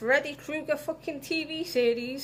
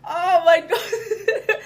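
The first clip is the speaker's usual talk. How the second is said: slow, loud, laughing oh my God; laughing disbelief; 3 semitones higher, 8 dB louder, much slower than usual. Retold or imitated. Reacting to the fan, laughing in disbelief. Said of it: "I'm like".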